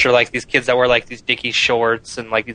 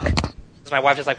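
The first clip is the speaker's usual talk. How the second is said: ordinary talk, nothing marked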